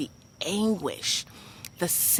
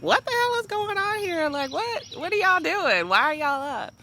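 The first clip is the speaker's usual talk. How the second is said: put-on high, loud, grumpy old lady complaining; cranky older lady; 12 semitones higher, 3 dB louder than usual. Older roommate woken by crying: cranky, high, loud complaint. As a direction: squawk like a grouchy woman woken at night. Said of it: "so she's kinda cranky".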